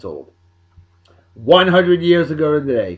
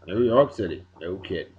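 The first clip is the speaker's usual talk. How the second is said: "New York City" dropped lower and softer under the breath; muttered repeat; 8 semitones lower, 8 dB quieter than usual. Acted. Host repeating the new name to himself, low and soft.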